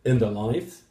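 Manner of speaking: "in the life" as one separate group, lifting at the end; phrasal grouping. Shown as a demonstration. Teacher showing grouped words, "in the life" as its own chunk. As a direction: chunk "in the life" apart from the rest. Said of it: "you group the words that go together".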